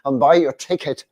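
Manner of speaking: a mock Irish rant, punched out hard; angry Irish accent. Manager swearing at the record label boss on the phone, loud Irish accent. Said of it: "his strong Irish accent".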